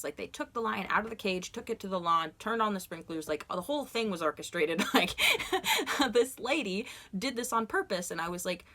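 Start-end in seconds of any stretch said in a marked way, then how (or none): 5.2-6.1 laugh. Real, not acted. Laughing at the dream.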